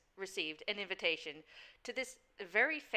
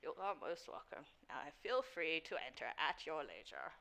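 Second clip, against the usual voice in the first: soft, lower, deferential servant voice; formal doorman; 6 semitones lower, 12 dB quieter than usual. Acted. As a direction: explain it with measured courtesy. Game master plays doorman.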